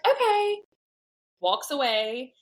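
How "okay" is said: very high, drawn-level okay; imitated mom's okay. Mom answers before walking away, high-pitched imitation. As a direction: mimic her reply in a much higher voice.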